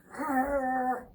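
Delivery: loud growl; angry growl. Acted. Bruce growling at the messy mice. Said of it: "Bruce says".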